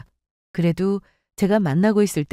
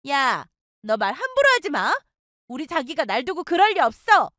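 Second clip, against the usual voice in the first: higher, defensive and huffy; indignant friend; 6 semitones higher than usual. Acted. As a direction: snap back, offended.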